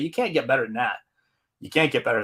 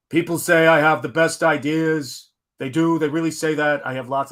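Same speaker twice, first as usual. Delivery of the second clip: terrible Trump; 3 semitones higher, 4 dB louder than usual. Retold or imitated. Streamer redoes a quote as Trump, hammed-up and loud. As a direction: puff it up like a bad Trump impression. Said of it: "That was a terrible Trump".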